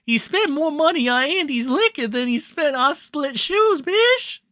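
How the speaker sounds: high-pitched put-on accent impression; Ralph. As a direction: squeal it out in an exaggerated accent. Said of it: "Do I have to do that in the Ralph accent?".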